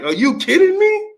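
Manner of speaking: very high, incredulous mock Manny; imitating Manny. Host repeats Manny's punchline, high incredulous. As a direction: squeak it out in disbelief.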